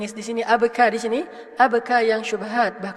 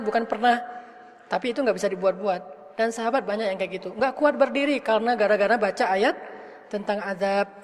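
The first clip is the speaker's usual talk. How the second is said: usual speaking voice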